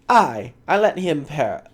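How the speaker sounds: put-on rough Scottish accent, faltering start. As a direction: stumble in a loud Scottish brogue. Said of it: "But I can't do a Scottish voice".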